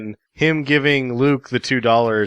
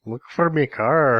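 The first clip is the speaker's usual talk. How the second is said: put-on Irish accent; 3 semitones higher, slower than usual. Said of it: "so he's also Irish".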